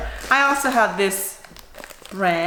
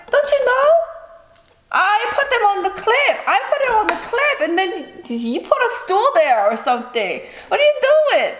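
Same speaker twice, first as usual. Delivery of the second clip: much higher, laughing scold; exasperated high scolding; 11 semitones higher than usual. Retold or imitated. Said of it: "I was like".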